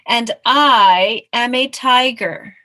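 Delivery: loud, drawn-out proclamation; grand insistence. Acted. Mouse correcting the tiger, loud and grandly sure. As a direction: swell hugely on the "I".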